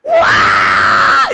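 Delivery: loud retold sobbing howl; crying wail. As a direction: wail like a wronged old lady. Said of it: "Et elle pleure, et elle hurle". (cri en pleurs imité)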